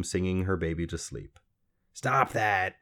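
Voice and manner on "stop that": mildly sharp croak; croaky little man. A narrator's reading. Little man to singing mother, curt command. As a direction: interrupt as a strange little man.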